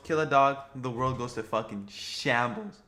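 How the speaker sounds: ordinary talk, nothing marked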